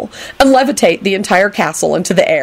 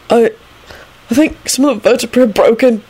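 high, halting mumble; nervous, pitched-up mumbling; quick mumble; 6 semitones higher than usual. A narrator's reading. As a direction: mumble it hesitantly. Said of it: "he mumbled quickly".

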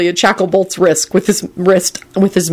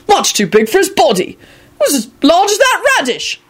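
decisive; 11 semitones higher than usual. A narrator's reading. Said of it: "he told the rest of the group decisively".

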